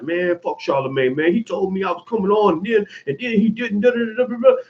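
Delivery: whiny put-on complaint, rising in pitch; mock griping. Pretending to complain about a cancelled show, mock griping. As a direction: grumble in mockery, running off into babble. Mimics complaint he refuses to make.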